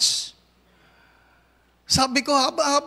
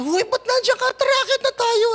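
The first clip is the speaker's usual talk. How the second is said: high calling voice; 13 semitones higher, 4 dB louder, faster than usual. Retold or imitated. A friend calling her over, high and bright. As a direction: call out to her from afar.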